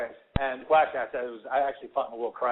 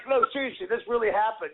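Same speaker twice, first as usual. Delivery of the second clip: pleading insistence; 7 semitones higher than usual. Retold or imitated. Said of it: "rolling their eyes".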